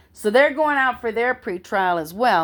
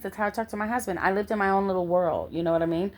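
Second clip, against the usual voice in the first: ordinary delivery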